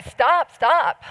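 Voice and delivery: high mock child's shout of stop; retold child's cry. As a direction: cry out stop like a child seeing an animal hurt. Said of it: "and cry and say".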